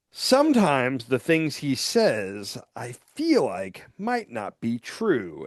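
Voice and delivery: slow, halting mom imitation; reluctant mom. Skit mom admitting doubt to her kids, slow and reluctant. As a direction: drag out a grudging admission as the mom. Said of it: "then she would be like".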